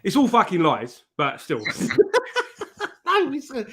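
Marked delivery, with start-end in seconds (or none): 1.7-3.0 high laugh. Real, not acted. Laughing at guest's reply.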